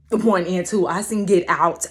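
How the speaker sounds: usual speaking voice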